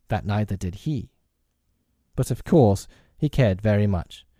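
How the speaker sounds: ordinary delivery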